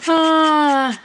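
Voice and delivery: long, high, loud "ah" sigh; laughed-out sigh. Real, not acted. Crafter recovers from a laughing fit, big sigh. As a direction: let the laughter out in one sigh.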